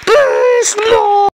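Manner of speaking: high, loud wailing plea; drawn-out, high-pitched begging; mock pleading. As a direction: cry out in pretend desperation. Pleading while hit in game.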